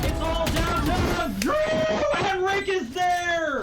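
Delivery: high, slow hype call; excited shout. Real, not acted. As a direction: draw out the big moment. Final duel, caster shouts.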